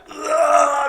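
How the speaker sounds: high drawn-out "ugh"; mock stretching groan. Joking about a stretch, mock groan.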